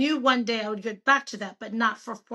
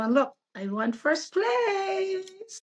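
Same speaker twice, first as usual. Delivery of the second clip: excited; 8 semitones higher, much slower than usual. Real, not acted. To her host, announcing her win with a high excited shout. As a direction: burst out with the news of winning.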